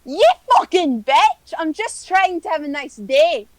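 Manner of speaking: hurt and outraged; angry yell. Acted. Druid yelling at the dwarf who hit her.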